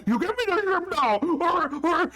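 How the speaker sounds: put-on a big baby threatening to hit; childish brute threat. GM as Gus threatening Leona, high whiny brute voice.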